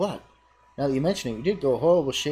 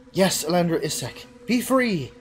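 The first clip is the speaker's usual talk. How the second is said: much higher, pleading; melodious voice; 4 semitones higher, 4 dB louder than usual. Acted. Fiola to the hero, high melodious plea. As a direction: lift him toward freedom. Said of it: "A melodious voice".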